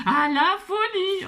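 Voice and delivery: drawn-out laughing "à la folie". Real, not acted. Teacher delighted her wheel landed on folly, laughing slowly. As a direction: stretch it out with a laugh.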